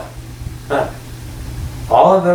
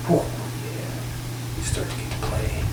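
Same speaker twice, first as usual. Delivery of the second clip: whisper; 9 dB quieter than usual. Real, not acted. Channeled guidance trailing to whisper.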